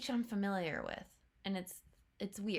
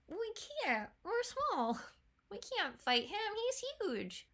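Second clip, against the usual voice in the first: much higher, small scared voice; timid little toad; 10 semitones higher than usual. Retold or imitated. Fan wishes the toad sounded frightened, squeaky and high. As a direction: squeak it out like a tiny nervous creature. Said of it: "I wish he said something like".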